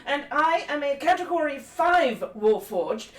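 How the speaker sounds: put-on proud, emphatic old-soldier speech, slow opening; indignant lecture. Crom to rude guard about manners, indignant formal lecture. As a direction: dress him down with formal, ringing indignation. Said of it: "Oh, the lecture's gonna begin".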